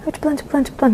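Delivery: soft, high, repeated urging; a hushed re-enacted scramble to get away; urgent hurrying. Papa chasing from behind, hushed urgent hurrying.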